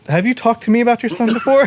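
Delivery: puzzled high question; surprised. Real, not acted. GM confused by question.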